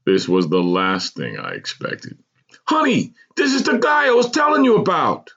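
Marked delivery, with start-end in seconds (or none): 2.6-5.3 very high, thrilled voice; wide-eyed excitement. A narrator's reading. Husband presenting old schoolmate to wife, wide-eyed excitement. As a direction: gush it like showing off a hero. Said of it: "he said with wide-eyed excitement".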